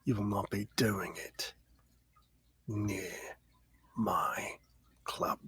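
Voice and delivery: low, hushed warning, almost a whisper; a hushed menacing warning to the Avatar near the club; quiet threat. Acted. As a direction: lean in and threaten softly. Gang boss warns a visitor.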